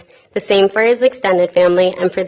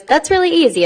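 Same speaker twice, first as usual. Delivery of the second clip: high-pitched, breezy confidence; breezy joke character; 9 semitones higher than usual. Acted. Woman in a joke answering a riddle, breezy and high. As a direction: toss it off high, as if it's easy.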